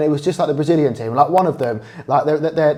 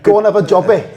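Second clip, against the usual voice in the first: loud, higher put-on Scottish accent; 6 semitones higher, 7 dB louder than usual. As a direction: mimic a Scottish fan, louder. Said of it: "Don't criticise my Scottish accent".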